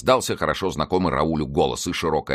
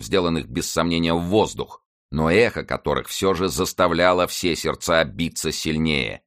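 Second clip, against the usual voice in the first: ordinary delivery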